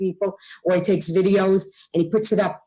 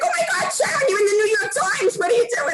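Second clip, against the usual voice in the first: high squealing excited friends; mock excited friends shrieking with surprise; 12 semitones higher than usual. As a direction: squeal it like thrilled friends calling out. Friends' excited reaction quoted.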